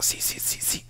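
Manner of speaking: breathy unvoiced whisper, almost silent; whispered agreement. To the ushers after being caught, whispering. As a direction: murmur it meekly, just breath. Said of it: "Y era como".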